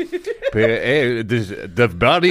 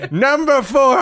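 shouted announcement; 12 semitones higher, slower than usual. Pretend. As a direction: bellow it to the crowd. Crier announcing the segment.

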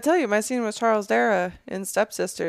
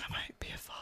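whispered mock tearful line; 20 dB quieter, much faster than usual. Quoting co-star's joke tearful moment, whispered. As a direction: breathe it out as a fake tearful father.

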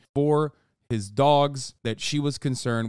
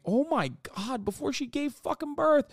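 much higher, stunned outcry; shocked disbelief; 13 semitones higher than usual. Real, not acted. Streamer learning of texts sent the night before the birth, shocked high outcry. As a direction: gasp it out in horror. Shocked reading the divorce timeline.

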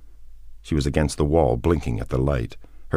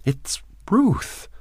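very high, frightened voice; terrified. A narrator's reading. Woman repeats her name to him, terrified. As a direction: say it again in fear. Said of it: "she said again".

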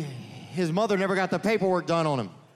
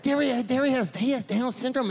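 lightly voiced kids, higher and insistent; mock kid voice; 8 semitones higher than usual. Kids tell Derry's mom the truth, in a high mock voice. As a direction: mimic the neighborhood kids explaining. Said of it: "We'd be like".

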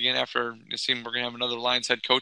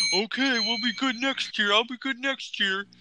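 high, slow naive fan voice; mock hopeful young fan; 8 semitones higher than usual. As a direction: parody your younger self's blind hope.

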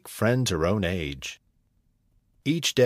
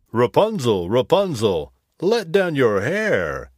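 prince calling; 3 semitones higher, 4 dB louder than usual. Acted. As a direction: call up clearly as the prince. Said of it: "he called".

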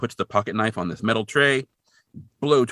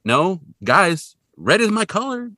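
higher and louder, protesting cheerfully; hammed-up insistence; 9 semitones higher, 7 dB louder, slower than usual. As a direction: plead the ascot's case. Dean insisting on ascot.

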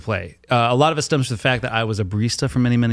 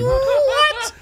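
high squeaked disbelief. Retold or imitated. Retold baffled reaction.

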